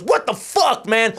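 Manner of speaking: high-pitched angry yell; mock furious outburst; outraged bystander. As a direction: shout it as if just bumped into. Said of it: "He stands up and goes".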